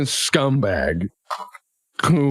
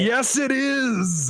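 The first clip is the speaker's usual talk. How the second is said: high, drawn-out relish; gleeful announcement; 10 semitones higher, much slower than usual. Real, not acted. Game master announcing a dreaded roll, gleeful. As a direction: sing it out long and high.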